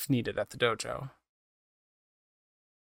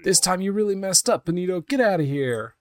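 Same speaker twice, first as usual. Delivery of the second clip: much higher, loud furious order; angry barman; 8 semitones higher, 4 dB louder than usual. Acted. Barman yelling at Benito to get out. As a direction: throw the drunk out with a yell.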